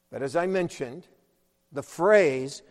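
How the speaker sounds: usual speaking voice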